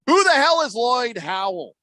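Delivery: high, slow, drawn-out incredulous question; big mock-baffled outburst; exaggerated bafflement. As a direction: ask it in hammed-up disbelief. Mock-serious question after build-up.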